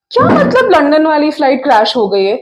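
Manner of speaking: loud, rapid stage protest; alarmed disbelief. Acted. Woman told on the phone of a plane crash, alarmed denial. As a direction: burst out fast, insisting it must be a mistake.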